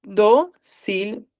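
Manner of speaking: "Dócilmente" drawn out very slowly, syllable by syllable. Shown as a demonstration. Teacher to students, splitting the word, very slow. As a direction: break "Dócilmente" apart slowly for the class. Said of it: "veamos dócilmente".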